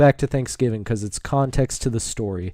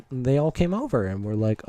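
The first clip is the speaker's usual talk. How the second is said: ordinary talk, nothing marked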